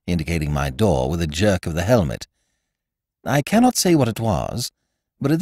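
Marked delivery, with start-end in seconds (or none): none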